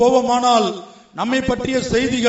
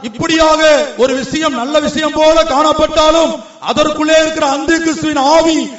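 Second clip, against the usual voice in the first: forceful preaching; 5 semitones higher, 5 dB louder than usual. Real, not acted. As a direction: proclaim it to the congregation, phrase by phrase.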